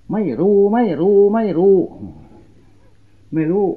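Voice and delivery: high, loud repeated denial; loud "don't know". Retold or imitated. Villager loudly refusing the monk's request, high and loud.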